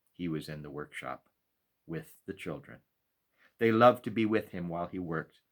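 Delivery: plain reading voice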